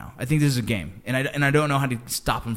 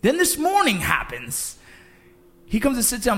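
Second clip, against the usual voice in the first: excited; 10 semitones higher than usual. Real, not acted. Retelling disbelief.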